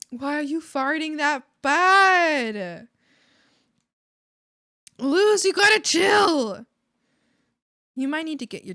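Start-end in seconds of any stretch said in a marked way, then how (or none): 0.1-6.7 loud, high, drawn-out protest; stretched-out loud complaint; loud exclaiming. Real, not acted. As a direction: call it out in loud exasperation. Exclaims at farting Luz.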